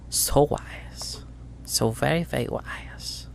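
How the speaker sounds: hushed mocking praise, slowing down at the end; mock praise whisper. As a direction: purr the praise with scorn. Crime boss mocking softly.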